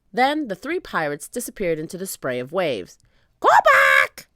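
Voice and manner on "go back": loud, screeching parrot voice; parrot squawk. A narrator's reading. Polly warning the pirates from above, squawking. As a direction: screech the words like a bird. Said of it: "squawked Polly".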